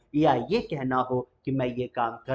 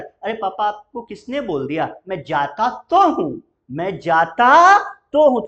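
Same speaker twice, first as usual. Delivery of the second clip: louder and higher, leaning hard on तो and जाता; emphatic protest; 6 semitones higher, 9 dB louder than usual. Shown as a demonstration. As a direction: insist you really do go, pressing the words. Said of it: "emphasise कर रहे हो, दबाव के साथ कह रहे हो". (जोर देकर कहना, "मैं जाता तो हूँ")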